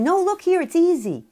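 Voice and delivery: high, loud, brushing-off parent; dismissive parent. Retold or imitated. Parent brushing off a struggling child, high and loud. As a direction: wave the problem away, high and loud. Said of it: "we can stop ourselves from saying".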